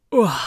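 loud, falling groan of reluctance; weary groan. Acted. Sergeant facing bad weather, loud groan. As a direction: groan loudly at the thought.

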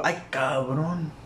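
slow, drawn-out "Ay, cabrón"; weary Vision groan. Retold or imitated. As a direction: stretch it out, beaten. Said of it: "el Vision blanco dijo".

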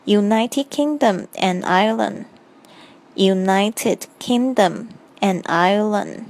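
United Kingdom and Ireland, slow and careful; slow model phrase. Shown as a demonstration. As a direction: say it slowly and clearly. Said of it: "再来是英国与爱尔兰".

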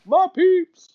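high put-on voice for my peeps; hammed-up slang voice; mock cool-kid voice. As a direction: squeal my peeps like a goofy teen. Mocking the phrase my peeps.

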